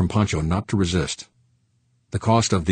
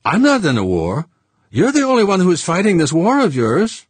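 higher, louder French voice with heat; heated Frenchman; 12 semitones higher, 7 dB louder than usual. Acted. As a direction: push back hotly at Fuchs. Saint Clair heated at Fuchs.

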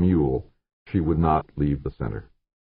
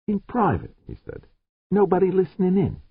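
much higher, firm; George's voice. Acted. George insisting to the security chief, much higher. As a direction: state it high and firm.

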